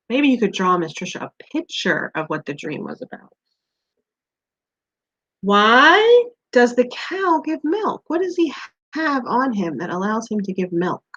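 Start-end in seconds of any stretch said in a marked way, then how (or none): none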